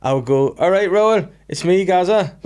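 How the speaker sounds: imitating Gazza greeting Raoul; Gazza impression. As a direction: call out like a giddy drunk footballer. Gazza voiced in retold interview.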